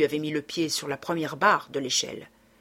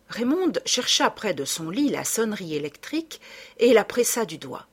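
ordinary delivery, nothing marked

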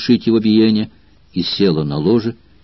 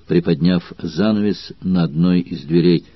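usual speaking voice

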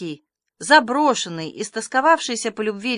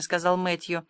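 plain reading voice

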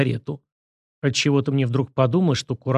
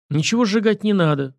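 mildly stern, raised pitch; a firm, understated rebuke; 7 semitones higher than usual. A narrator's reading. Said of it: "сурово отозвался я". (сурово, твёрдо)

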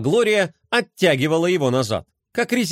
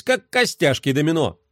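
plain reading voice

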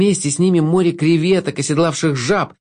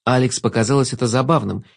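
ordinary delivery

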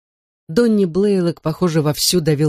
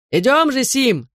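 high-pitched holler to a friend; calling out; 8 semitones higher than usual. A narrator's reading. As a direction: call him over from the porch, mildly raised. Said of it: "закричал с веранды Донни Блейлок".

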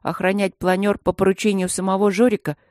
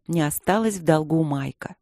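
usual speaking voice

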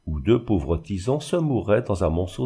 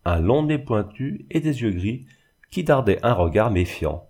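usual speaking voice

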